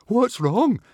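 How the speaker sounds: high, loud anxious question; shivery alarm. A narrator's reading. Swimmer asking what is wrong, shivering. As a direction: ask in a frightened high voice. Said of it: "shivered Fishlegs".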